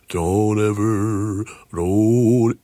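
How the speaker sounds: slow, yawning crooner voice. Shown as a demonstration. Singer showing the natural yawn in a star's voice, drawn-out yawning sound. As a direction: stretch each word on an open yawn. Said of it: "natural yawn".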